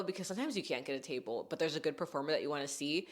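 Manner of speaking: usual speaking voice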